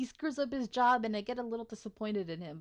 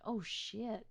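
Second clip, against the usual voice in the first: ordinary talk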